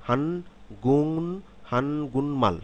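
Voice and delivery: teaching repeat of "হান গুনমাল", sounds linked; nasalised k. Shown as a demonstration. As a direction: lift and lengthen "গুন" with a nasal ending, no k. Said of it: "শব্দটি যখন আমরা উচ্চারণ করব".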